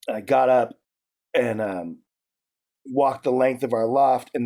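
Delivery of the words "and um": ordinary talk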